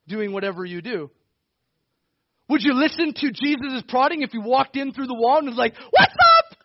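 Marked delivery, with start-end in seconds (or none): 5.9-6.6 high, loud, hammed-up "what's up"; casual shouted greeting. Preacher imagining Jesus walking through the wall, high loud casual shout. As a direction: yell it like a buddy bursting in.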